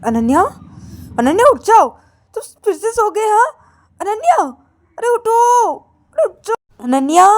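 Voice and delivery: high, loud sister voice calling to wake her; shrill-pitched nagging wake-up calls; Payal waking sister. Acted. Payal wakes sleeping Ananya, loud high nagging.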